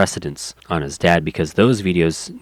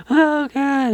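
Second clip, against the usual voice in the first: mock crying daughter; 3 dB louder than usual. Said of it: "sob, sad thing".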